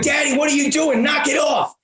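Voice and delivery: imitating a kid's high-pitched yell; scared little daughter. Little daughter tells dad to stop, high scared yell. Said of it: "She was scared".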